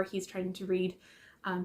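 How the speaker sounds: usual speaking voice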